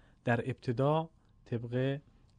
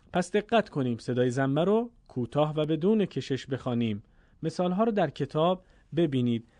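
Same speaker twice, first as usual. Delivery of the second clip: ordinary talk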